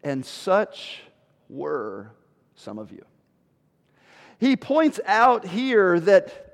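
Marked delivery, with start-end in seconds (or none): 0.0-3.0 slow, dropping low and hushed; weighty verse reading. Shown as a demonstration. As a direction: let it sink, pausing between words. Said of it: "look what he says in the beginning".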